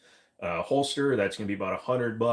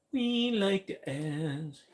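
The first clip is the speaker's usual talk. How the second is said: slow silly voice; 8 semitones higher, much slower than usual. Acted. Slow higher funny voice.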